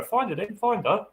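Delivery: high-pitched, eager urging to a dog; excited dog command. Acted. Owner urging dog to search.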